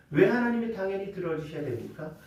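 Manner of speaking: ordinary talk, nothing marked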